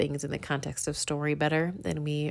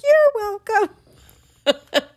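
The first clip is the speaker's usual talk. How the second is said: put-on high, very slow child voice; boy's polite reply; 8 dB louder, much slower than usual. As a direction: say it like a proud little boy. Boy replies to neighbour.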